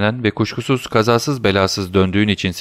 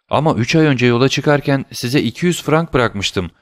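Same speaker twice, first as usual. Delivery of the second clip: ordinary delivery, nothing marked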